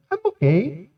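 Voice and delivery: high, soft mock "I'm fine"; pretending to be okay. Man hiding hurt when asked how he is, falsely okay. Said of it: "we like to pretend we're okay".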